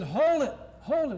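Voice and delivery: loud high "hold it" shouted in anger; irritated host halting the taping, shouting angrily; angry. Retold or imitated. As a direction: stop them short, angrily and loud. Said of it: "he was very angry".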